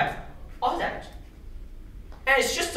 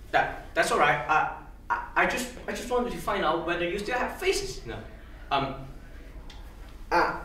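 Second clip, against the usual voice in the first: hesitant, stammering climb-down after an outburst; halting apology. Acted. Mister Smith apologises to the honorable body, faltering. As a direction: apologise haltingly, restarting your sentences.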